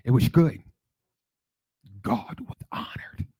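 shushed S in "was", loud then dropping to whisper; Margaret Washington. Retold or imitated. Imitating a missed colleague, "was" said "wash", then whispered. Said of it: "She really used to talk like that".

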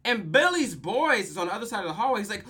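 ordinary talk

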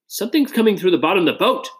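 fast, high-pitched yell; high, panicky, rapid cry; alarmed yell. A narrator's reading. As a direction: yell a warning in a rush. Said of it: "one of them yelled".

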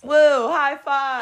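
slow, loud, high woo; cheering. Real, not acted. Celebrating high five.

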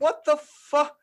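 very high, loud disbelief; mock shock. Viewer learning it's a Best Buy ad, mock shock. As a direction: burst out in hammed-up shock.

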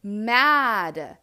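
very slow, word by word; emphatic venting. Retold or imitated. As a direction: drag out each admission of being mad. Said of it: "was like".

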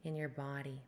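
guided meditation. Acted. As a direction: ease them into the breath. Leads a breathwork meditation.

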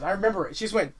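ordinary talk

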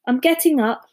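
plain reading voice

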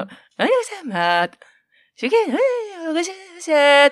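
garbled announcer imitation. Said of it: "極端すぎる人で".

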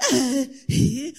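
ordinary talk, nothing marked

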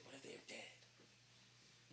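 slow, drawn-out whisper; hushed dread. Acted. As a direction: whisper it like a creeping worry. Dreading thought about unanswering customer.

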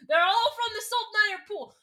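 giddy conspiracy breakthrough; laughing excitement. Real, not acted. Host cracking the conspiracy, laughing and excited.

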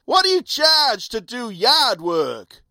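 high, slow, condescending lady; the lady asks about yard work, slow and condescending; lady's voice. Retold or imitated. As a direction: talk down to the gardener, slow and high. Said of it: "The lady then asked".

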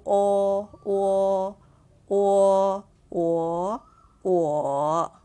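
o drawn out loud, dipping in third tone; third tone vowel. Shown as a demonstration. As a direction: stretch o long and loud, dipping then rising. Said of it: "It's pronounced as u".

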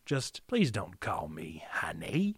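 low, slow, creepy whisper; Willem Dafoe impression. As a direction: whisper it like a menacing villain. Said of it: "Is that a good Willem Dafoe?".

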